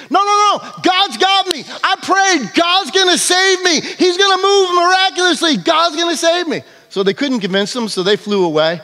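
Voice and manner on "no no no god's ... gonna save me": high, insistent no-no-no; stubborn refusal. Acted. Man in the boat refuses the rescue boat, high and insistent. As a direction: wave them off, sure God will come.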